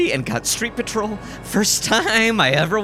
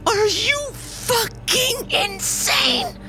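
high, slow, every syllable punched; hissed furious shout; whisper yelling; much slower than usual. A narrator's reading. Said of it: "he whisper shouted, accenting every syllable".

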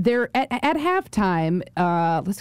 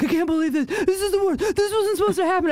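high-pitched, laughing mock despair; mock distraught friends; 11 semitones higher, faster than usual. Girlfriends texting about the losing game, high mock despair. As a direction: wail it like friends in meltdown, half laughing. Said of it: "They're like".

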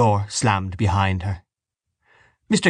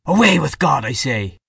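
loud, higher burst, dropping on the last words; angry repetition; 5 semitones higher, 4 dB louder than usual. A narrator's reading. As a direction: insist with a defiant cry. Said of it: "He stared before him out of his dark".

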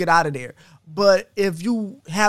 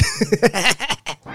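gremlin laugh. Real, not acted. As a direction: cackle like a gremlin. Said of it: "You sound like a gremlin".

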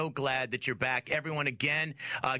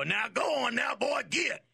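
high country-guy holler; hollered country-accent demo; 7 semitones higher than usual. Shown as a demonstration. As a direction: demonstrate a country accent, pitched way up. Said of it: "do your country accent and go".

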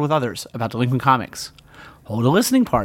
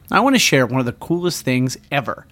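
ordinary talk

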